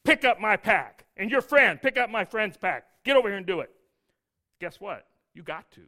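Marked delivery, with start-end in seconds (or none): 0.0-3.7 loud barked orders; bossy soldier. Retold or imitated. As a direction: bark at him like an arrogant Roman soldier. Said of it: "he walks over and says".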